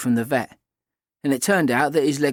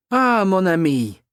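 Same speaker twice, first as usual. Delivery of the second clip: high, drawn-out French exclamation; French accent; 6 semitones higher, 4 dB louder, slower than usual. A narrator's reading. Kat playing French to the narrator, in a French accent. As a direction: greet a friend in a slow, higher French accent. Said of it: "in a perfect French accent".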